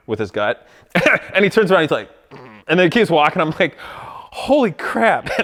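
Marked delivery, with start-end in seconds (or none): 3.8-5.3 stunned. Retold or imitated. Retold stunned reaction to salesman leaving.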